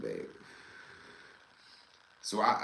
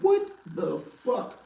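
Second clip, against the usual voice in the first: slow, higher, drawn-out what; stunned disbelief; 7 semitones higher, slower than usual. Retold or imitated. Seeing his friend crying by the welcome sign, slow stunned disbelief. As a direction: drag it out in disbelief.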